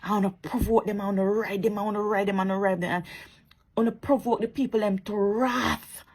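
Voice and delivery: ordinary talk, nothing marked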